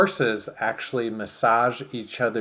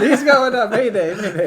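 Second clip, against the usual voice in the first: mock mayday call; 10 semitones higher, 7 dB louder than usual. Playful mock distress call.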